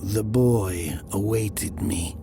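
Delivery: ordinary delivery, nothing marked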